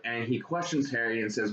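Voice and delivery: ordinary talk, nothing marked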